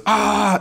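high, strained cry of pain; a hammed-up wounded yelp; mock pain scream. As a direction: imitate the kid yelling over his leg. Injured kid yelling.

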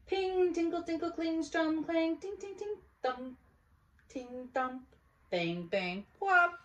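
high playful sound words; ping out the band sounds; instrument noises. Acted. Olivia playing her one-girl band, high sound effects.

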